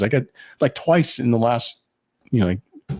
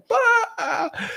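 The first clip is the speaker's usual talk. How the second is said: high shrieking laugh burst; burst of laughter. Retold or imitated. Her friends bursting out laughing, high shriek. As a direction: mimic friends cracking up in a high squeal. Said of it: "freaking busting up".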